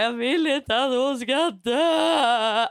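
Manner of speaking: slow, drawn-out tearful wail; half-crying, stretched-out lament; childlike wail. Retold or imitated. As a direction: reenact a child crying in bed. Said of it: "jag låg och bara".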